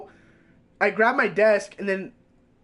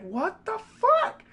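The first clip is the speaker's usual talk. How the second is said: high, slow, stunned what the fuck; mock disbelief; much slower than usual. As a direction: stretch it out in disbelief. His stunned reaction to the teacher.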